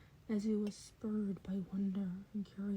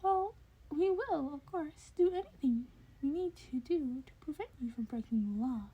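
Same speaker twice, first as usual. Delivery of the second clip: high, deliberate creature voice; higher, louder, measured start; 6 semitones higher, 5 dB louder than usual. A narrator's reading. Voices Nezu character.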